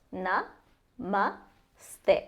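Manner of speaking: na, ma, ste split apart; syllable by syllable. Shown as a demonstration. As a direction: say "Na, ma, ste" one syllable at a time. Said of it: "made up of three syllables".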